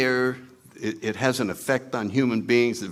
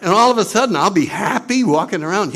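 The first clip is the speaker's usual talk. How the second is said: mock dread; 7 semitones higher, 5 dB louder, faster than usual. Ego dreads becoming happy.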